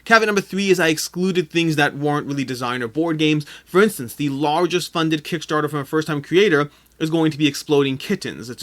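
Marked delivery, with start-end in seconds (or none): none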